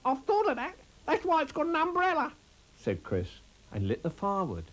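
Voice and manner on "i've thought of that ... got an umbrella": squeaky rabbit voice. Acted. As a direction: squeak it out like a small excited rabbit. Narrator voices Chris Rabbit.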